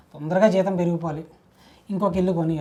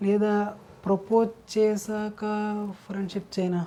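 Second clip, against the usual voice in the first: slow, soft deliberation; pondering aloud; 6 dB quieter than usual. Acted. Man deciding whether friendship or proposal comes first, pondering. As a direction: weigh each option slowly, thinking aloud.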